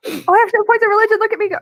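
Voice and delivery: high, fast excited outburst. Real, not acted. Player reacts to a failed roll.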